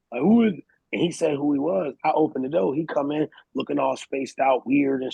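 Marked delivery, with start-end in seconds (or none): 0.2-0.6 lightly put-on man voice, raised and louder. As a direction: call out like a man guarding the door. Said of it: "I put on my man voice".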